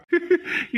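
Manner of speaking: short, high chuckle; amused laugh. Acted. Husband amused by wife's crying, laughing. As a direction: chuckle at her needless tears. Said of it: "எனக்கு சிரிப்பு தான் வருது".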